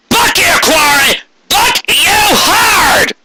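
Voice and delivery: high, furious scream. Acted. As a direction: scream it right in his face. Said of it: "he screamed".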